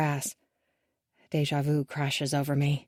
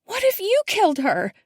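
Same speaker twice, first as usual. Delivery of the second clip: high, shaking question; barely able to say it; horrified accusation; 13 semitones higher, 4 dB louder than usual. A narrator's reading. Daughter accusing her father, choking on the words. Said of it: "she chokes on the words".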